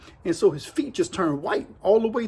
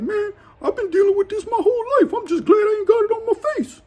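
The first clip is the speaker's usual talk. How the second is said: much higher, louder put-on voice; Honky Feet's voice; 13 semitones higher, 4 dB louder than usual. Storyteller voicing Honky Feet to inmates, high put-on voice. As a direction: play the touchy inmate, pitched up.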